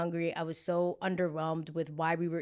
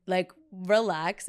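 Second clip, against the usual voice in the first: drawn-out relax; 5 dB louder, much slower than usual. Retold or imitated. Telling the husband to relax.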